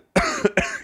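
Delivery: a hard chesty cough. Real, not acted. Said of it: "You okay with that cough?".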